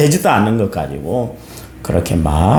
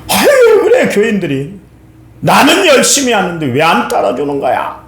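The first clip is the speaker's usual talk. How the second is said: grumbling pastor; 8 semitones higher than usual. Retold or imitated. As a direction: moan about lazy church members.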